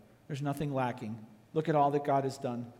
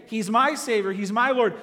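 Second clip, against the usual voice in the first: public declaration; 5 semitones higher, 5 dB louder than usual. Shown as a demonstration. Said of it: "You have to publicly say".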